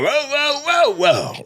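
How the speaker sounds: "whoa" barks starting high, then dropping; comic's stylised dog sound effect; imitated dog bark. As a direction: bark four rhythmic woofs in one cadence. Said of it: "he was doing sound effects".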